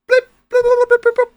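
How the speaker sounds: very high, loud blip blip blip; imitating text beeps. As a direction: squeak the beeps like a game's text sounds. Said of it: "instead of beeps on text".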